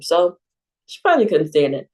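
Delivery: ordinary delivery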